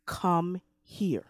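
God softly calling a weary believer near. Acted. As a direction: call them close, tenderly and quietly. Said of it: "the soft voice of God that says".

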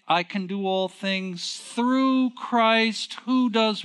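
slow, drawn-out preaching of the verse, pausing for the crowd to answer "what"; scripture recitation. Acted. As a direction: intone it slowly like a pulpit reading, leaving a gap for the answer. Preacher quotes scripture, congregation answers.

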